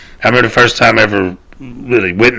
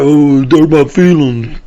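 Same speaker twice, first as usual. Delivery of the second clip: drawn-out, higher mock complaint; mocking whine; 5 semitones higher, 4 dB louder, slower than usual. Mocking the easily offended over hurt feelings, slow and whiny. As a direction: imitate a wounded complainer, dragging the words.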